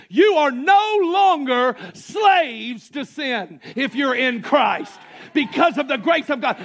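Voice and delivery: shouted, high-pitched sermon climax; full-force preaching. Acted. Preacher shouting freedom to the church. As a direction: preach it out loud, rising and shouting.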